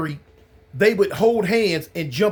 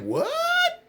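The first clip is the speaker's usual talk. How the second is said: mock shock, pitch jumping way up; a squeaked, disbelieving "What?"; 4 dB quieter than usual. Playing a listener stunned by the prison wedding, high shocked squeak. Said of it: "I know some of y'all like".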